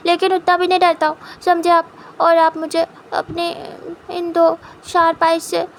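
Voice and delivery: a cute spouse puffing himself up, scolding; pouty defiant young husband. Acted. Wing defies his husband.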